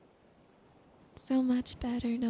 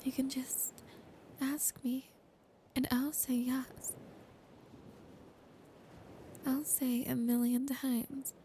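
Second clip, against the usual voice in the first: whisper. Acted. Girlfriend promising her partner trust and marriage, whispered. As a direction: close intimate ASMR whisper throughout.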